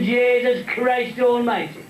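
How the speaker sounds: long-suffering passenger's exasperated oath; comic exasperation. Acted. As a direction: stretch every word in mock disbelief. Paddy reacting to the pilot's announcement.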